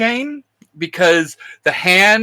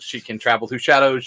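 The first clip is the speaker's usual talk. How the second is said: normal talking voice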